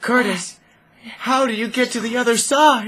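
loud high panicked call; panicked shout. Real, not acted. Stranded player yelling to friend, loud high panic. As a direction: shout for help across the gap. Panicked calling across gap.

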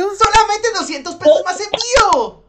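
high, slow, loud price reveal; infomercial host. Pretend. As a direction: announce the price like a TV ad. (presentador de teletienda)

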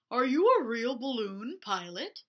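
a bear asking shyly but firmly. Acted. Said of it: "asked a bear".

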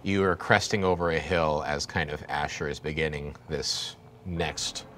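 ordinary talk, nothing marked

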